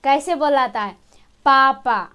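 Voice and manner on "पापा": पापा said slowly with both lips pressed shut; lip sound demo. Shown as a demonstration. Teacher showing students how पापा uses the lips. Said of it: "पापा बोल के देखिए".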